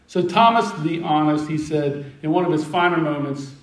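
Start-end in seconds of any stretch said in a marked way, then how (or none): none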